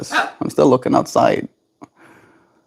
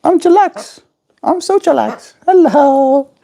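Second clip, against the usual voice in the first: squeaky high bird voice; high parrot voice. Pretend. Voicing the parrot again.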